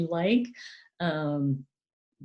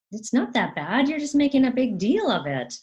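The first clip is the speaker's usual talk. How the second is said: high, louder mock chiding; dismissive parent; 8 semitones higher, 5 dB louder than usual. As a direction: imitate a parent brushing off upset. Parent voiced dismissing upset child.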